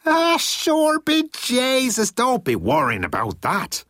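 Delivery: very high, drawn-out Irish opening; hammed-up stage Irish, swooping down from the top; Irish leprechaun act. A narrator's reading. Hillman brushes off a contract question, leprechaun Irish. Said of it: "did his number one leprechaun act".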